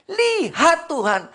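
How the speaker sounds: Lihat Tuhan cried out high, loud and slow; loud pleading cry. Acted. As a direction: cry out to God as the king. Hezekiah pleads with God. (raja berseru keras kepada Tuhan)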